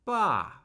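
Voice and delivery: Ba starting high, falling straight down; fourth tone on Ba. Shown as a demonstration. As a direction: drop "Ba" firmly, like a command. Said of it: "I'm starting higher".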